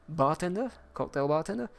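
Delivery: ordinary talk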